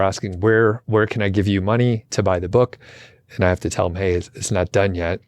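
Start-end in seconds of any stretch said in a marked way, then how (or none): none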